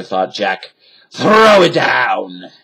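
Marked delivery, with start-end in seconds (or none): 1.1-2.5 loud, slow pirate shout; captain crying out. A narrator's reading. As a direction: bellow at the crew. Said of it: "cried Captain Bones".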